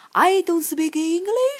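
慢慢说，ENGLISH 高高上扬并拖长; 拉高嗓音，把 English 拖成长长的上扬尾音; 夸张示范英语. Shown as a demonstration. 示范拒绝搭讪的英语. (English 高扬拖长地示范)

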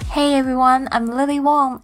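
normal talking voice

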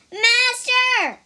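high, loud warning cry; urgent cry. Acted. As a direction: shout to stop him. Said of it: "cried the monkey".

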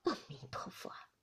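soft murmured prayer. Acted. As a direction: breathe it out like a relieved prayer. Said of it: "便合掌念声".

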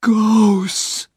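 put-on high frightened boy; scared Huck. Huck naming the ghosts, high and scared. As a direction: breathe it out in fear.

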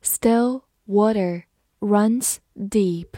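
teacher reading slowly. Shown as a demonstration. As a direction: read it slowly for the class. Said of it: "好，慢慢来读".

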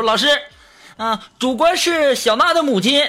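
high, projected schoolboy declaration; loud classroom answer. Acted. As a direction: call it out high to the teacher. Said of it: "我就高声，高声的对老师说".

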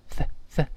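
"th" made with tongue between teeth, pulled back. Shown as a demonstration. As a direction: isolate the "th" as a voiceless hiss. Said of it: "T也是这个吐舌音".

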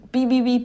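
mock bleep sound covering a swear; self-bleeping. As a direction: say beep beep beep like a censor tone. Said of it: "哔掉我刚才要说的话".

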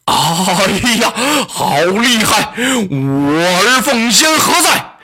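wounded tyrant's slow cry for help; crying out in pain. Acted. Wounded Dong Zhuo cries out for Lü Bu, slow and alarmed. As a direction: call for his adopted son in alarm. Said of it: "董卓大叫一声".

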